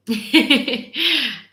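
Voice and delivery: amused, loud laugh. Real, not acted. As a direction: break into a giggle. Laughing at dinner plan.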